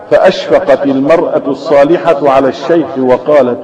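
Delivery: ordinary talk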